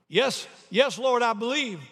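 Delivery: high, eager, desperate yes; urgent assent. Acted. Tourist assuring the Lord he believes, desperate. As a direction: agree quickly in acted panic.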